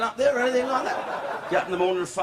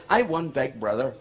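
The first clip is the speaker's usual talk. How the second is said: lower, puffed-up boast; mock self-important; 5 semitones lower than usual. Brian boasting about winning Big Brother, lower and puffed up. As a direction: say it like you're very proud of it. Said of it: "and he said".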